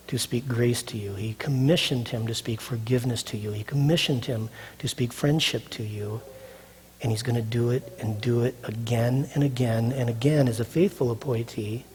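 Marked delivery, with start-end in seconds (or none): 0.1-6.2 hushed, breathy whisper of grace. Real, not acted. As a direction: lean in and whisper it. Preacher drops to intimate whisper.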